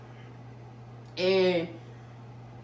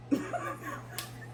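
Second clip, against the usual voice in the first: laugh; 11 dB quieter than usual. Real, not acted. Laughs mid-story.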